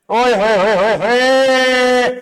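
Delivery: slow, drawn-out oye hoye of delight; hammed-up wah-wah; mock admiring cheer. Shown as a demonstration. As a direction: savour it like a great line of poetry. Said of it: "क्या बात बोली है".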